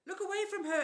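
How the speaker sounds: high, loud urgent shout. A narrator's reading. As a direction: yell a warning across the garden. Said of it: "Annabeth shouted".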